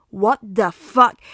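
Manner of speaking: slow, loud disbelief; outraged. Acted. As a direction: spit it out slowly. Katsuki outraged.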